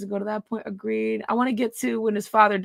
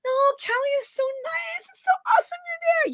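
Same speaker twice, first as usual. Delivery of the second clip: much higher, sugary mock voice; mocking falsetto. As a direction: parody him in a high squeaky voice. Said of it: "Here's this bitch trying to connect".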